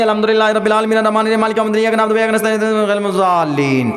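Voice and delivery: fast monotone gabble, words run together; mock hurried recitation. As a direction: race through it on one note in a single breath. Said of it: "ورنہ بس وہ تراویح کی طرح نہیں".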